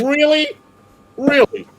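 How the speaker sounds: high, loud "really?"; hammed-up scandalised disbelief; acted incredulity. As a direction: push it high and loud, appalled. Scandalised at the money request.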